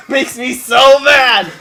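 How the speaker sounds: laughing. Acted. Laughing mock outrage at game.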